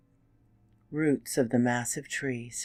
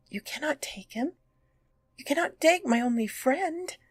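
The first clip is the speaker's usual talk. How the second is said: desperate plea; 13 semitones higher, 7 dB louder than usual. Acted. Boy pleads with the Stag King.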